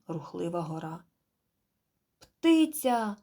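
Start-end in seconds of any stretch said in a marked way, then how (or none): 2.2-3.2 loud call of surprise, higher; shout. A narrator's reading. Bargemen spotting the goose, loud surprised shout. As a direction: call out as you spot a bird. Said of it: "закричали з баржі".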